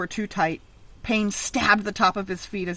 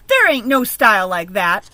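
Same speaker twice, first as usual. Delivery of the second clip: louder, scornful bully retort; growled; 9 dB louder than usual. Acted. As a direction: shove the words at him with contempt. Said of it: "Angel growled".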